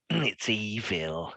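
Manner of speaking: very slow, drawn-out creepy delivery; hammed-up creepy menace, lingering on every syllable; really creepy. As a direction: stretch each word out eerily. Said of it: "in a really creepy way".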